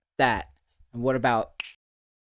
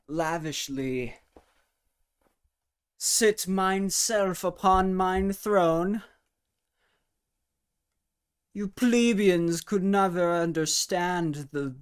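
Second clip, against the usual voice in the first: pompous royal; 5 semitones higher, slower than usual. Pretend. Pretends to sit on a throne.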